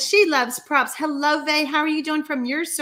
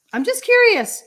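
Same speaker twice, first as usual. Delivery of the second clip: high, louder mock-innocent curious; sing-song teasing question; playful teasing; 11 semitones higher, 5 dB louder than usual. Real, not acted. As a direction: tease them, all innocence. Teasing viewers, high and loud.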